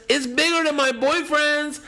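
put-on high squeaky girlish gush; girl's verdict. Girl in green room reports to crowd, high gush. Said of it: "She says".

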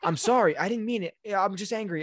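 high-pitched, pleading apology; flustered apologetic mom. Retold or imitated. Mother apologizes to wounded son, imitated flustered regret. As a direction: imitate a mother hurriedly backing down.

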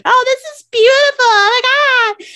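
high, loud breathless swooning over beauty; gushing mock attendee. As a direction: gush like a dazzled newbie.

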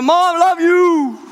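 high, loud, slow call out of a car window; shouted I love you from a departing car; shouting out the window. Retold or imitated. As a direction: yell it back over your shoulder as you drive off. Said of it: "so I shout out the window".

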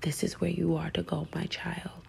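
soft, unvoiced whisper; whispering voice of Spirit. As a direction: whisper it like a guiding voice. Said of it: "through voices".

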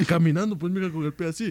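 normal talking voice

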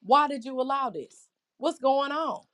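mild imitation of a bitter man railing at God; a hint of angry frustration; voicing a bitter man. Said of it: "This man is saying".

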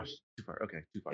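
whispered. Real, not acted. Backing off, muttering to himself.

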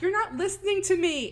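retold yell at her dismissive friends. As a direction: shout it at friends who won't listen. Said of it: "she's yelling at them".

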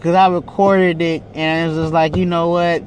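usual speaking voice

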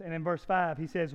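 ordinary delivery

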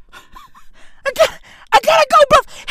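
high, breathy laugh through the words. Real, not acted. Telling viewers he must leave, laughing high. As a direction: laugh as you squeak out the line.

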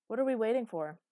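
loud call across the room. Acted. She calls to Conrad across the office, loud. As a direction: call over to him at the window. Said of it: "I called to him".

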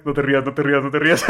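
friend's voice holding back laughter; trying not to laugh. Retold or imitated. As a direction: say it while laughter leaks. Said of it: "Y el Max".

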